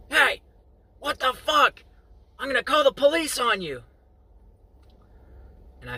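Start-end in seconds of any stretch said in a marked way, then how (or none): 0.1-3.8 much higher, louder shrill-free mock old lady yelling; angry old lady. Neighbor lady yelling at boys throwing rocks, high and loud. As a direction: play the furious neighbor catching kids. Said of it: "and she said".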